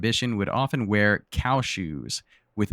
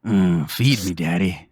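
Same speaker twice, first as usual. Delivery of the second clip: talking toilet; 4 semitones lower, slower than usual. Pretend. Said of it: "What is the toilet's voice?".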